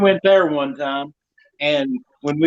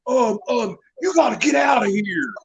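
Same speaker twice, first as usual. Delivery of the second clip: flustered clown; 6 semitones higher than usual. Retold or imitated. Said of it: "he goes".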